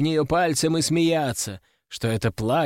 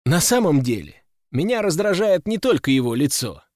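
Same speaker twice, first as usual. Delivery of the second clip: ordinary delivery, nothing marked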